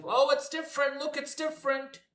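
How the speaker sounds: high, mocking fan voice, gasping at nothing new; high mock-amazed fan voice pointing at a rerun; mocking gullible fans. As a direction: parrot the easily fooled fans in a high mock-awed voice. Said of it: "to make the fans be".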